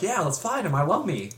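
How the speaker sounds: mock the hero's cheer; mock upbeat hero. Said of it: "It's like".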